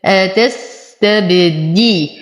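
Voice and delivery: measured Quranic syllables in أتستبدي, with a full kasra on د; slow tajweed recitation. Shown as a demonstration. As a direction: recite أتستبدي slowly, bouncing the ب and completing the i after د. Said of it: "أتم كسرة الدال". (تلاوة مجوّدة متأنية لكلمة أتستبدي مع إتمام كسرة الدال)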